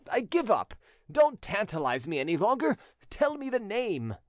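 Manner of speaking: falter, then give up and plead; pleading notary. Acted. Notary begs widow to end the riddle, agitated pleading.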